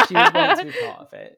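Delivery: high loud laughing words. Real, not acted. Teasing about sister joining the show, laughing. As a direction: giggle it out.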